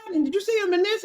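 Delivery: high-pitched imitation of an eager proud mom. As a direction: mimic the excited mother rattling off his credits. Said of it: "she's like".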